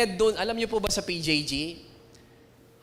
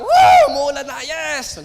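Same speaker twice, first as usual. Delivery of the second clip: much higher, loud mock whoop of joy; excited cheer; 7 semitones higher, 9 dB louder than usual. Someone thrilled by rain, loud whoop. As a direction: cheer like a kid seeing rain.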